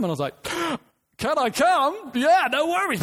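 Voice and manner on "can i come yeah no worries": eager yes. Retold or imitated. Answering Josie's request for a lift, eagerly thrilled. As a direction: jump at the chance, thrilled.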